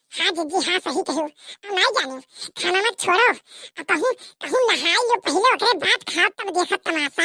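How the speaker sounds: ordinary delivery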